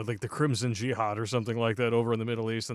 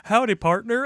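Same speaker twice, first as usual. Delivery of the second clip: a hammed-up Southern howdy, higher; mock Southern cowboy greeting; southern accent; 8 semitones higher, 4 dB louder than usual. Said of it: "Sandman's southern accent".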